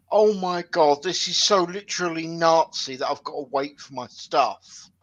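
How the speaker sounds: put-on loud, whiny teenage complaint; whiny kid voice. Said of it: "Then I hear".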